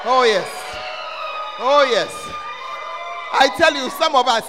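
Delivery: loud, exultant pulpit shouts; big preacher's exclamations; full-force preaching. Real, not acted. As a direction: shout it out to the congregation.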